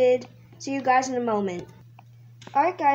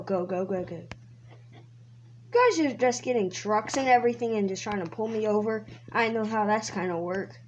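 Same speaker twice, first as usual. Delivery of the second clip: usual speaking voice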